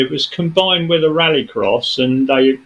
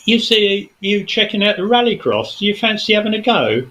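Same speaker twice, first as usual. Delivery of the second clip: higher, eager reporter's question; 7 semitones higher than usual. Retold or imitated. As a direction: pitch it up like a TV reporter asking Jimmy. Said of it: "and he says".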